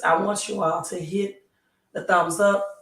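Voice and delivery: usual speaking voice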